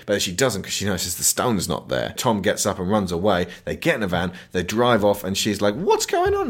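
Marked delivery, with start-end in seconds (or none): 5.7-6.5 squeaky, much higher mock panic; mock confused woman. Mocking the mummy woman's confusion, squeaky high. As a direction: ask it in a silly high voice.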